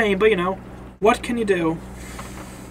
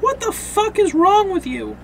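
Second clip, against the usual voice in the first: high-pitched indignant question; incredulous, high-voiced demand; acted outrage; 11 semitones higher than usual. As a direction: act appalled and angry, voice pitched up. Acts Laurie's angry question.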